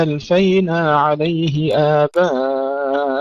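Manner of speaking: slow, drawn-out melodic tajweed chant; Quran recitation. Acted. Teacher reciting verses slowly to his students. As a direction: recite it slowly, stretching each vowel.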